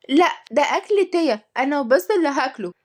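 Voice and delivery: put-on high-pitched child voice; high childish objection; refusing little girl. A narrator's reading. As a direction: protest possessively like a small child. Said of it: "قالت تيا برفض".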